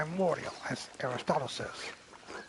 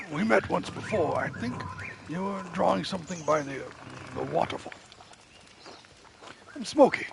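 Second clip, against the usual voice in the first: Smokey voice; 2 semitones higher than usual. Acted. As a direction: play the shy forest charcoal burner, pitched up. Voicing the charcoal burner in-game.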